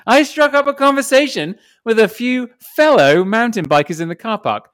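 high, lively storytelling; story read-aloud. Acted. Reading Evan's forgotten-helmet story to the hosts, animated read-aloud. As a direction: read it out with bouncy emphasis.

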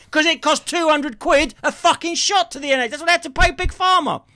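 high-pitched, outraged tirade; heated rant. Real, not acted. Ranting to co-host about NHS treatment, outraged and high-pitched. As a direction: argue it indignantly at a high pitch.

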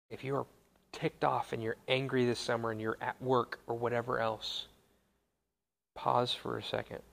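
soft, low-key, with long pauses; hushed reflective preaching. Real, not acted. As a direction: let each thought settle in quiet reverence. Reflective close of the sermon.

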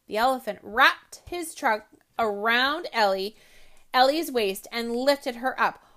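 lively storybook read-aloud voice; story narration. A narrator's reading. Host reading a picture book to children, animated.

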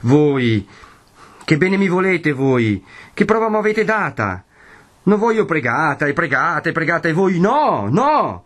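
high, wounded reproach building up; bitter anger. A narrator's reading. Renzo reproaches his betrothed, bitterly angry. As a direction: accuse her with rising hurt. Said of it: "una voce che esprimeva un'ira ben diversa".

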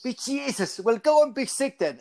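high mock-Irish dismissal; Irish accent. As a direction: imitate an Irish father losing patience. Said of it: "that's my Irish accent".

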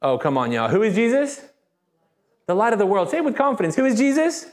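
ordinary talk

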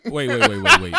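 loud, high, laughing waits; excited interruption. Real, not acted. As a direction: cut in laughing. Stopping the story.

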